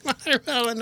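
high, sing-song camp watermelon. Acted. Answering the cohost's joke in a high camp voice. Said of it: "I turned into Jordan Ampersand".

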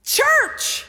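high, emphatic sermon delivery; preaching. Real, not acted. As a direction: preach it out with stretched key words. Preaches at raised pitch.

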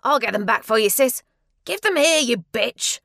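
loud, high, furious shouting; angry shouting. Acted. Elder brother shouting at the girl during the sandal fight, furious. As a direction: bark it as you grab for the sandals.

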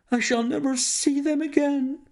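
high, anguished banker's lament; banker crying out. Acted. The despairing banker to Holmes, high anguished cry. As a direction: wail it as all hope goes. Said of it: "he cried".

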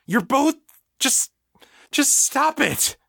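very high, halting, panicky outburst; edge of panic. A narrator's reading. Egan between two fighters, voice edged with panic. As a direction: stumble into a desperate order to stop. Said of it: "the panic edging into his voice".

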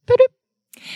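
ordinary talk

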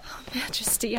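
soft grateful whisper. Acted. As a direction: breathe it to him. Queen whispers thanks.

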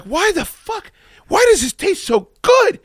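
high, loud amazed outburst; wide-eyed squeal of disbelief; amazed exclaim. Retold or imitated. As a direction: blurt it out like you just tasted heaven. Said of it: "I'm like".

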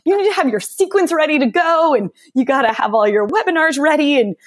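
high, pressed mock panic; anxious disbelief. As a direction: play your own anxious outburst. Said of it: "you can even hear in my voice".

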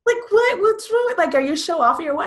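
high, loud exasperated questions; indignant questioning. Retold or imitated. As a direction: challenge the silly rule, fired up. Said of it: "And I was like".

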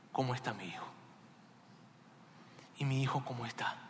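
very soft, low question fading to whisper; hushed worry. Acted. Father asks after his son, hushed and anxious. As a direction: ask almost under the breath, with long pauses.

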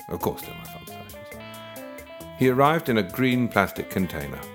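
usual speaking voice

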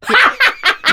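high villain cackle; maniacal laugh. Acted. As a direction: cackle like an evil overlord. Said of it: "you are the queen of maniacal laughter".

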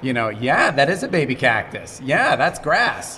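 father cooing to his son on camera. Real, not acted. Talking to his baby son.

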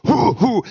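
hammed-up exertion puffs; mock workout grunts. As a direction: imitate a guy straining through push-ups. Imitating Steven's exertion grunts.

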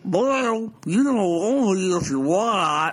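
slow Southern marbles-in-mouth drawl. Retold or imitated. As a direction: talk like a Southern politician with marbles in his mouth. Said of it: "that tone that he says Jeff Sessions ... with marbles in their mouth".

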